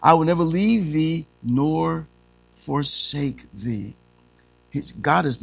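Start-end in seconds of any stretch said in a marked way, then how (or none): 0.0-3.9 slow, weighty scripture repeat; solemn slow reading; slow emphatic reading. Shown as a demonstration. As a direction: stretch out each word. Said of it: "What did he say down there?".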